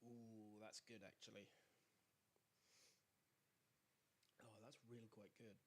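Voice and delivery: quiet words between sips of a drink; savouring a sip, barely voiced; sipping whiskey. Real, not acted. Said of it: "I will mute because it's slurping noises".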